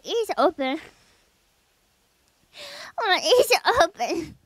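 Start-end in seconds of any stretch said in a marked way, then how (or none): 2.9-4.4 words breaking into giggles; laughing. Real, not acted. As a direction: try to talk through the giggles. Breaks down laughing mid-sentence.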